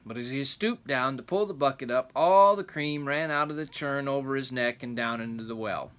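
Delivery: rambling grandpa story. A narrator's reading. Reading the folk tale aloud.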